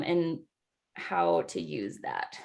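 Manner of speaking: normal talking voice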